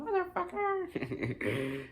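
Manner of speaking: high, drawn-out comic cartoon voice; mock insult voice. Retelling the hand insulting Ash, high mock voice. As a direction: stretch it out high in a put-on squeal. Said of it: "it flicked him off and called him".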